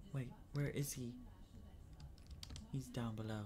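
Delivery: ordinary talk, nothing marked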